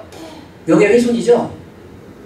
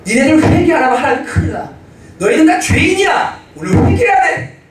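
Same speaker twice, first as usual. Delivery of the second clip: rising urgent preaching; 7 semitones higher than usual. Retold or imitated. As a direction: push it up to a fervent call to repent. Preacher scolding children to repent.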